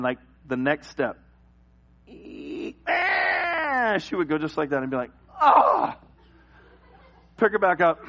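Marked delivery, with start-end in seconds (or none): 2.9-4.0 high, drawn-out creaking noise; long high squeal; imitated floor creak. Creaky floor step wakes baby. 5.4-6.0 sudden loud, high wail; short mock wail; imitated baby cry. Baby startles awake.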